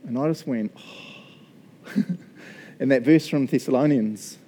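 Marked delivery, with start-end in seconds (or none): 0.8-1.9 hushed, voiceless exhale; breathy oh. Retold or imitated. Skeptic floored by the prophet's answer, breathy stunned oh. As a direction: let out a tiny winded "oh".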